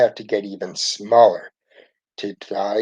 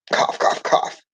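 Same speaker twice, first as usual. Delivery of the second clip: voiced coughs. A narrator's reading. Sick Ralphie coughing.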